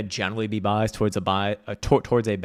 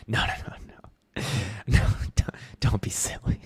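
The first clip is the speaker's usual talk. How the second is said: breathy laughing whisper, brushing it off. Real, not acted. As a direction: laugh it off under your breath. Laughing off a chat question.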